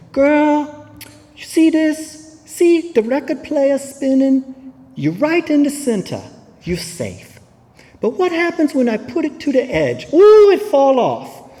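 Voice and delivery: put-on doing her sponsor's Hawaiian-Puerto Rican-Southern accent, high and coaxing; Ignacio's Hawaiian-Puerto Rican-Southern accent. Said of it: "that's an accent that you just, I've never heard before".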